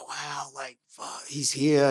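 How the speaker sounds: hushed, starstruck disbelief; awed hush. Retold or imitated. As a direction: breathe it out in awe. Starstruck meeting his hero.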